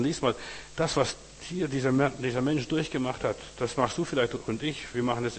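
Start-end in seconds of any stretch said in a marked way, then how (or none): none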